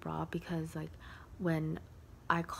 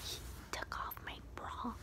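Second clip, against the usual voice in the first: hushed confession; whisper; 3 semitones higher, 10 dB quieter than usual. Real, not acted. Confiding to viewers the embarrassing part, whispered. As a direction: whisper it like a secret.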